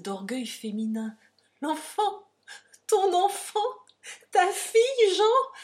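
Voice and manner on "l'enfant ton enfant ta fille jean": very high, loud proclamation; exultant shouted revelation; triumphant. A narrator's reading. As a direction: cry it out in building triumph. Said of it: "en deux mots triomphants".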